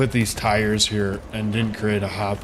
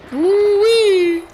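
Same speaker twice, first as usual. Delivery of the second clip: high, loud whoop of joy; excited whoop; 9 dB louder than usual. Real, not acted. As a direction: whoop like you just won.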